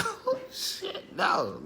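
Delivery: a high breathless yelp of fear, half-laughing; mock scared. Retelling the gunman scene, mock scared yelp. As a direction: gasp it out like a gun is pointed at you. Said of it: "I get scared as shit".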